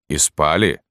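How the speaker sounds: firm question in the sergeant's voice. A narrator's reading. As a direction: ask it squarely, without raising the voice. (вопрос сержанта, твёрдым голосом)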